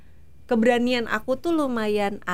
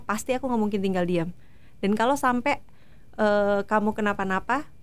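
usual speaking voice

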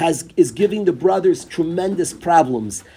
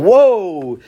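sudden louder, drawn-out swell; fired up; 6 dB louder than usual. Shown as a demonstration. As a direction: let the voice rise in a burst of excitement. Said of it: "the voice rises, and all of a sudden".

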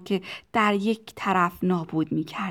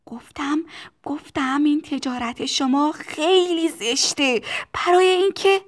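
put-on high child's voice building louder; insistent protest; 7 semitones higher, 6 dB louder than usual. A narrator's reading. Tistu repeating that the trade is ugly, high building protest. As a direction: repeat it, rising to a high cry.

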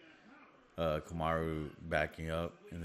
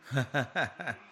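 short high burst of laughter; quick real chuckle, pitched up; amused laugh; 8 semitones higher, 3 dB louder than usual. Real, not acted. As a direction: laugh out at the action. Amused at the fight.

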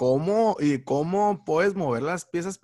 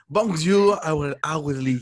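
French accent; 4 dB louder, slower than usual. Shown as a demonstration. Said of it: "mi François, no es tan, tan correcto".

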